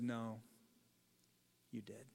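low, hushed verdict; quiet, knowing contradiction as the Lord; the Lord correcting. Acted. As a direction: drop low and very soft, with a pause before the verdict. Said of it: "And the Lord said".

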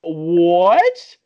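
loud, high, rising "what"; stunned disbelief. Retold or imitated. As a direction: blurt it out in outraged disbelief. Retold reaction to twelve dollars.

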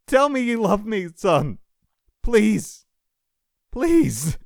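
high, loud anguished cries; desperate pleading. A narrator's reading. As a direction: shout pleas while being pulled away. Dying father pleads, still shouting.

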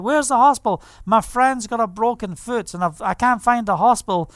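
usual speaking voice

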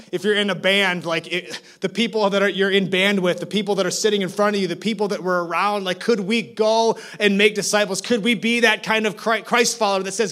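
loud, high, urgent preaching; preaching at full force. Real, not acted. Preacher urges the church to make disciples, loud. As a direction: drive the call home with urgency.

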